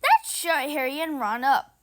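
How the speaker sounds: plain reading voice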